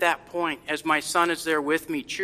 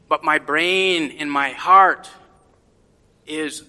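ordinary talk

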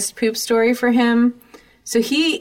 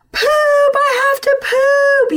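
put-on very high, slow, wailing child; screaming toddler; 5 dB louder, slower than usual. Toddler screaming from the back seat to his mom, high wailing. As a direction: wail it like a desperate toddler. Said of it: "he screams from the back seat".

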